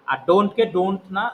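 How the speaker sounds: ordinary talk, nothing marked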